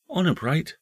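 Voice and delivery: put-on high-pitched small boy voice; earnest young nephew. Nephew asking his uncle for a pledge, high and earnest. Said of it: "said the nephew".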